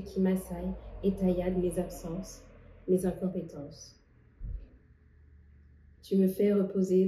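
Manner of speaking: measured poetry reading with long pauses; poem recital. Acted. Poet sharing her poem on rest with viewers, reciting.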